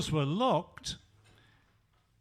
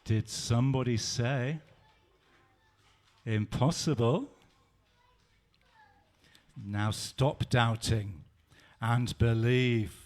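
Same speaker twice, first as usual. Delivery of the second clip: gentle Jesus; 9 semitones lower than usual. Acted. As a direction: lovingly coax him to believe. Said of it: "Jesus said".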